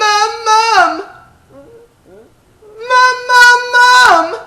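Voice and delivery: put-on high, loud child's call for mom; bright calling out. Shown as a demonstration. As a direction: shout for mom across the house.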